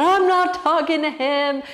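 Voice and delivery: high, loud, whiny refusal; hammed-up tearful tantrum; mock wailing. Upset student refusing to see her teacher, loud exaggerated wail.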